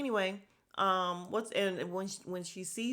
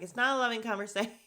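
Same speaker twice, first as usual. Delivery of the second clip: louder, higher, quick retort breaking up at the end; mock indignant contradiction; 5 semitones higher, 4 dB louder, faster than usual. Retelling Bria contradicting Jasmine, mock indignant. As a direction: snap back fast, stretching out the last word. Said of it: "And Bria's like".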